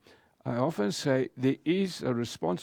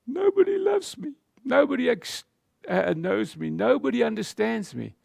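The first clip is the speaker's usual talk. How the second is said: lonely believer's lament; 8 semitones higher than usual. Acted. Voicing a lonely believer's complaint.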